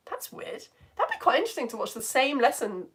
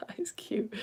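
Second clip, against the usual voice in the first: whisper; 3 semitones higher, 12 dB quieter than usual. Real, not acted. Cooing over cute pet photo.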